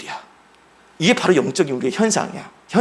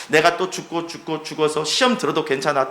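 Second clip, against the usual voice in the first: normal talking voice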